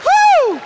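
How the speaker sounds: loud high whoop; a big excited woo; cheering whoop. Acted.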